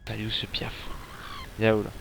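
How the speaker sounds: unvoiced whisper. Real, not acted. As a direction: whisper it to yourself. Quietly searching for a bird enemy.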